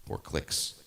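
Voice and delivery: talking through a blocked nose; congested, stuffed-up voice; stuffy nose. Real, not acted. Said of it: "I don't know why I'm stuffy".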